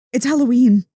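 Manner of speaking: excited insistence. Real, not acted. Insisting to the unexcited listener, loud and excited. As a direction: make them feel the thrill.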